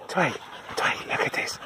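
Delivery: soft whispered call to the dog. Real, not acted. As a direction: whisper so only the dog hears. Quietly calling dog's attention.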